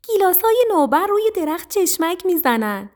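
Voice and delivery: high, loud squeaky-mouse enthusiasm; excited little mouse. A narrator's reading. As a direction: announce a treat high and eagerly. Mouse brings tempting cherry news.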